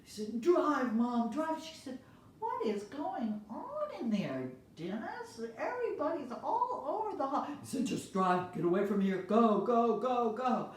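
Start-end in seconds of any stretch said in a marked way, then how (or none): 0.4-1.6 urgent plea. Acted. Boy urges mom. 2.4-7.4 puzzled mom. Acted. Mom asks, high. 7.8-10.7 loud, rising go go go; frantic urging. Acted. Boy begs mom to drive off, loud and frantic.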